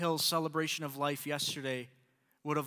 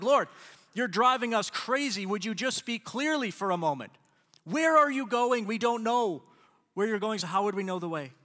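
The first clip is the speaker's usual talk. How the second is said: loud, fed-up outburst peaking on where are you going; a disciple venting at his teacher, voice raised; exasperated; 3 semitones higher, 5 dB louder than usual. Retold or imitated. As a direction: Thomas losing patience with Jesus. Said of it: "He's like, no, he's like".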